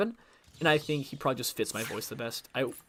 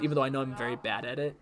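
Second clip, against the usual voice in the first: normal talking voice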